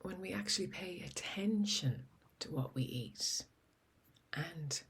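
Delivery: soft, breathy-free hushed opening question; hushed teaser. Acted. Host opens the podcast with a hushed teaser question. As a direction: draw the listener in quietly, almost whispering.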